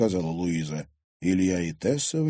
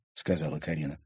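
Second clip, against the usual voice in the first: usual speaking voice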